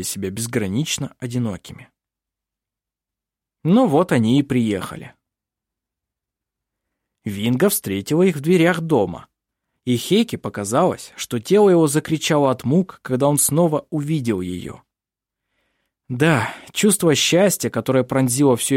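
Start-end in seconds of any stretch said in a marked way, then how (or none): none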